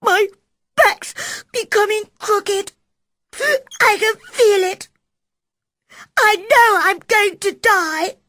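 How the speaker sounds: put-on high, loud, fast panicky boy's wail; tearful boy. Sick boy Colin fearing he will die. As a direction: blurt it out in terror. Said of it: "crying quietly to himself".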